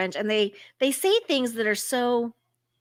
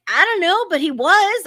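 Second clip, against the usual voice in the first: higher, louder mock-certain reply; emphatic insistence; 8 semitones higher, 6 dB louder than usual. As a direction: insist with a hammed-up shrug. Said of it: "He was like".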